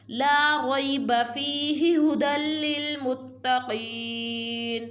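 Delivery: Quran recitation. Shown as a demonstration. Teacher recites for viewers in sung tajweed.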